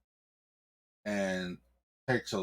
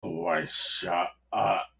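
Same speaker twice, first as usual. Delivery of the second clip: slow commanding order; slower than usual. Acted. Cardinal orders a killing.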